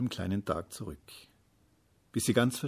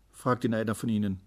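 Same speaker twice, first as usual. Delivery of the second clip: usual speaking voice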